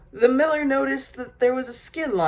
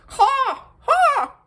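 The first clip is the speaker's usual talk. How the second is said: high-pitched imitated raven caw; raven croak; 3 dB louder than usual. A narrator's reading. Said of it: "so that it croaked".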